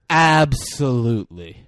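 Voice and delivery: slow, drawn-out nerdy affirmation; mock-nerdy, deliberate enunciation; nerdy delivery. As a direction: stretch it out like an earnest nerd. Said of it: "like, a nerdy way like that".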